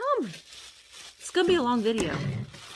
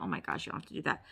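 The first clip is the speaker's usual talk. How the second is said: fast breathless whisper to herself; whispered aside; 5 semitones lower, 13 dB quieter, much faster than usual. Real, not acted. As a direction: murmur it under your breath, quickly. Aside while reading card.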